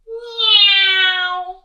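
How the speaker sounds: loud high whining plane swoop; child playing at aeroplanes; aeroplane noise. A narrator's reading. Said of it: "pretending to be an aeroplane".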